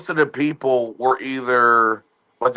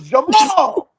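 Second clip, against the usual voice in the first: high, shouted call across the house; mother shouting for her son to come check; 14 semitones higher, 3 dB louder than usual. Retold or imitated. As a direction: yell for her son in another room. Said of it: "She goes".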